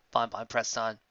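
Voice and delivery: ordinary delivery, nothing marked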